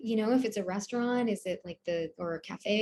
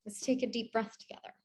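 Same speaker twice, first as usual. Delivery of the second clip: soft, low-key breathing guidance; calm meditation voice with long, restful pauses; guided meditation; 3 semitones lower, 6 dB quieter than usual. Real, not acted. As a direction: ease them into stillness, softly and unhurried.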